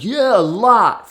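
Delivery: high, loud, drawn-out agreement; audience response. Retold or imitated. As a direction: imitate an audience emphatically confirming. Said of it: "I'll ask the audience".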